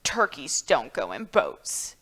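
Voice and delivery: low, grumbling turkey voice; Turkey refusing the boat, low and grumpy. Acted. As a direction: drop low and sound put out.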